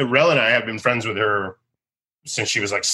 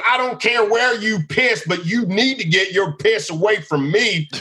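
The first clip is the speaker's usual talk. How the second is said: high, tense mock threat; menacing warning; 9 semitones higher than usual. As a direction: warn him off before you snap. Angry redneck at the bar.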